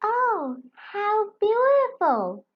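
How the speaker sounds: high, breathy gasp of delight; gasping in wonder. A narrator's reading. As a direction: marvel at the colours. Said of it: "Firenze gasped".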